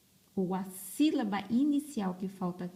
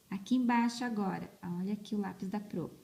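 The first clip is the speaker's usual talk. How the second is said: normal talking voice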